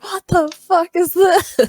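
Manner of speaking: angry. Retold or imitated. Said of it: "I was so angry".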